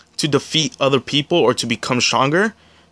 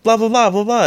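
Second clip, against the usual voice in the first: put-on childish babble; 10 semitones higher, 3 dB louder than usual. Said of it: "she's like".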